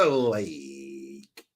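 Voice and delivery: put-on drawn-out Valley Girl "like" through a laugh; mock Valley Girl, laughing. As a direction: parody Valley Girl filler. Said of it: "Please do a Valley Girl".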